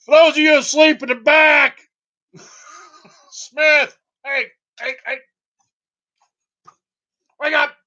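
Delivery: high, loud classroom yell; teacher shouting. Pretend. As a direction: wake sleeping students with a shout. Shouting to sleeping students.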